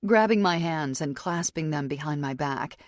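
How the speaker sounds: ordinary delivery, nothing marked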